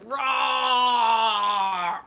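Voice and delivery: long, loud, drawn-out mock roar; hungry T-rex roar. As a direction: roar it like a ravenous dinosaur. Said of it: "I'm just like".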